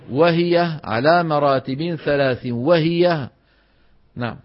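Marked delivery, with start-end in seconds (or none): 0.1-2.5 "وهي على مراتب ثلاث" said slowly with "وهي" as a separate word; correct reading. Shown as a demonstration. Teacher models the correct line for students. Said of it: "شو منقول؟".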